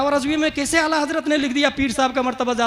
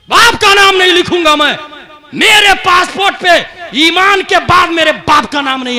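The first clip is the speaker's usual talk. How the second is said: raised, higher voice of indignant refusal; acted emphatic refusal; 7 semitones higher, 10 dB louder than usual. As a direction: act out the refusal forcefully for the crowd. Same refusal re-enacted forcefully. (ऊँची आवाज़ में ज़ोरदार इनकार)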